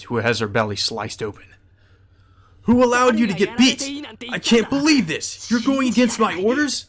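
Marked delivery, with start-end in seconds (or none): 2.6-6.9 high, angry berating shout; yelling Shinji. Acted. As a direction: scream at the servant for losing. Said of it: "Shinji yells".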